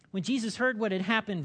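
ordinary delivery

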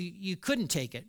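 usual speaking voice

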